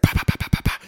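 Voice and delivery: imitated popping noise. Imitating father ripping off wires.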